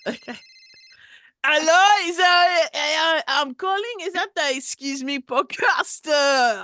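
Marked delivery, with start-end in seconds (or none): 1.4-6.6 loud, high put-on caller voice; overdone French caller; prank caller voice. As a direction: boom down the phone as a fake fan. Fake caller voice prank call.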